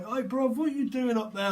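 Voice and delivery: a tiny ant shouting up at a giant; squeaky ant voice. Pretend. Psychic voicing an ant yelling up at a giant.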